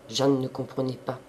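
ordinary delivery, nothing marked

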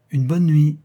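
calling out. A narrator's reading. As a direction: call it from the doorway. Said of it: "il nous cria de la porte". (lancé à voix haute depuis la porte)

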